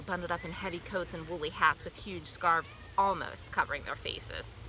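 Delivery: plain reading voice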